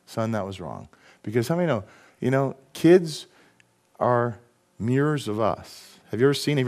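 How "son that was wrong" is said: much softer and lower, owning it gently; humble apology. Retold or imitated. Dad apologizing to wife and son, soft humble admission. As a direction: lower your voice and sincerely admit the wrong. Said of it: "Humble yourself and say".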